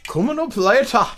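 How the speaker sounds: high, loud mock Scottish promo; Scottish TV announcer. Teasing the next show on Playboy Channel, mock Scottish. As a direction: announce it in a hammed-up Scottish lilt. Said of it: "Playboy Channel for fucking Scottish dudes".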